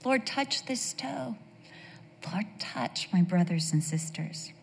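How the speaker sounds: high, soft plea to the Lord; pleading prayer. Acted. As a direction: act out a hushed bedtime prayer. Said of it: "don't you go".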